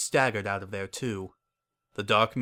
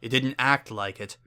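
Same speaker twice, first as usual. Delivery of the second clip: ordinary delivery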